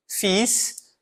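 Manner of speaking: "Fils" with the final S sounded, fis; teacher says "Fils" sounding the final consonant; French demo. Shown as a demonstration. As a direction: model "Fils" and voice the S. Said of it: "pronuncio a vogal final".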